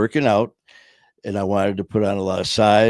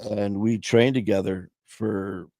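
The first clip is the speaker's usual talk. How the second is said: ordinary delivery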